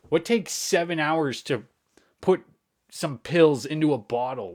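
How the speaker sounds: loud retold indignation; indignant. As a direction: demand it in disbelief. Retelling own angry reply.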